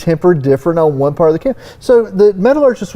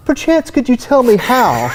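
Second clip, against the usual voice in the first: pinky-up posh voice, pitched higher; mock posh; 6 semitones higher than usual. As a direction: ham up the genteel "Perchance". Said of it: "my pinky up".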